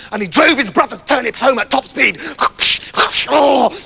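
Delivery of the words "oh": ordinary delivery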